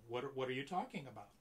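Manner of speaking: ordinary delivery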